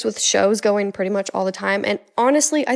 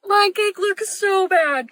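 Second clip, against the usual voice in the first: gasping dismay; 8 semitones higher, much slower than usual. Pretend. Gasping at her ugly cake.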